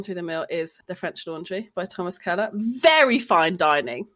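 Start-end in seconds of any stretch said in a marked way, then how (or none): 2.5-4.1 drawn out. Real, not acted. Savouring the phrase.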